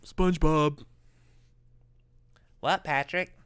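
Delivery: a hint of Patrick and SpongeBob; lightly voiced cartoon characters; SpongeBob cartoon voices. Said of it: "I do cartoon voices".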